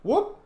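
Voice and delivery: quick high-pitched "whoop". Real, not acted. Gamer caught off guard while playing, high whoop. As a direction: let out a short, high whoop. Startled exclamation mid-play.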